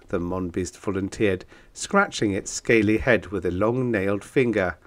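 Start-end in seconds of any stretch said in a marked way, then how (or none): none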